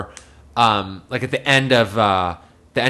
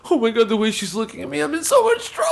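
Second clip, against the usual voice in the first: high wail climbing much higher; mock tearful panic; 9 semitones higher than usual. Friend sees mom glaring from the car, mock crying panic. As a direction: imitate a sobbing kid, rushing then wailing. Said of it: "he bursts into tears".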